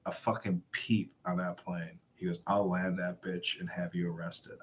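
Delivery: a hushed warning; quiet threat. Retold or imitated. The captain warning him before boarding, a quiet menacing threat.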